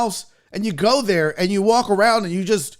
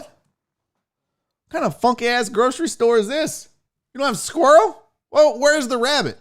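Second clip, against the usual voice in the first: can't believe the store lacks squirrel; baffled outrage; 6 semitones higher than usual. Retold or imitated. Tribesman baffled in grocery store.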